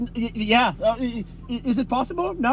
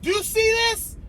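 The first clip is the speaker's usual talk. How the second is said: much higher, incredulous mock rant; inner disbelief; 13 semitones higher than usual. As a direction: play the outrage he only thought. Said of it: "I didn't say all that inside".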